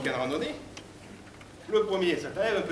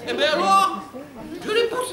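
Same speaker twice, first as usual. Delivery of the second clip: loud, very high falsetto outburst; indignant bimbo; 12 semitones higher, 7 dB louder than usual. Acted. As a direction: blurt it out in a shrieky bimbo voice. Bimbo confesses she pushed him. (voix de bimbo criarde)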